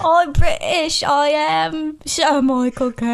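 slow, protesting British impersonation; Michael Caine. Acted. Guest defending the impression to the host, slow put-on British voice. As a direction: draw it out in a hammed-up English accent. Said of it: "Have you seen a movie with Michael Caine".

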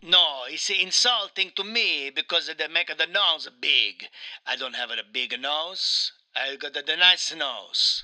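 put-on Italian accent, aggrieved game character; Mario with Italian accent. Mario complains to his interviewer, in a thick Italian accent. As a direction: play an indignant Italian Mario.

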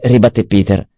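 plain reading voice

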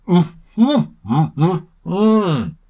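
high, loud closed-mouth grunts; urgent mumbles through puffed cheeks; breath-held humming. A narrator's reading. Sam answers Ollie while holding his breath, muffled humming. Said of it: "took a big breath and puffed his cheeks out".

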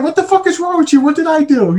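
high-pitched imitation of his wife, baffled; mimicking wife. Imitating his wife's confused reply, high mocking voice. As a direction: squeak out the wife's indignant questions. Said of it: "she's like".

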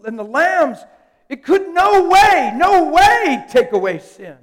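loud pulpit preaching that slows down at the end; full-force preaching. Acted. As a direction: hammer it home like a revival preacher.

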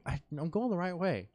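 arguing back. Retold or imitated. Driver arguing with the GPS, mild retold protest. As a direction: retell yourself insisting to a machine. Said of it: "I'm arguing with the G P S".

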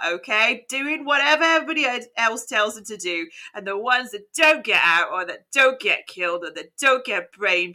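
low Cockney gangster reading; Cockney accent. Acted. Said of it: "You're gonna do the Cockney British accent".

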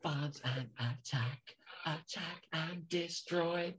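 slow whispered alien drone; breathy slow mechanical chant; alien robot voice. Retold or imitated. Imitating Mechanoid voice.

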